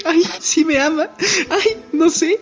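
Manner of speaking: mock lovestruck giggle; giggly blush. Kaguya overhearing praise, giggling lovestruck squeal.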